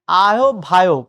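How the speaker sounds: "আহ্বায়ক" loud as a-ho-bhayok; common mispronunciation. Shown as a demonstration. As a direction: give "আহ্বায়ক" an extra o and no au glide. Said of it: "আমরা ভুল উচ্চারণ করি".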